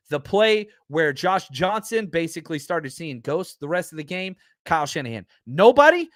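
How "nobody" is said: higher, louder, outraged question; incredulous. Real, not acted. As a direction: ask it in total disbelief. Ranting about unblocked defender.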